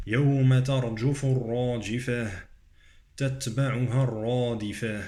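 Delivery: recited with full dark r in "الراجفة" and "الرادفة"; measured tajweed, back vowel after r in "الرادفة"; heavy ra. Shown as a demonstration. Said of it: "الراء مفخمة".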